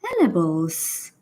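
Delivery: puzzled British echo of "ELL-uh-bulls"; British lady softly trying out a garbled word, SYLLABLES as "ELLerbulls"; very British accent. Retold or imitated. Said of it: "says it with a very British accent".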